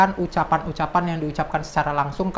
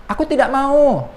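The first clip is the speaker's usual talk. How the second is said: "Aku tidak mau" said higher and louder, falling hard on "mau"; direct speech refusal; 9 semitones higher, 6 dB louder than usual. Shown as a demonstration. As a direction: act out a stubborn refusal in quotes. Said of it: "misalnya gini".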